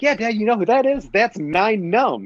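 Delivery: high, excited fan voice; eager kid. Acted. Kid showing Dad an obscure Star Wars fact, excited. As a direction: rattle it off, then draw out the name proudly.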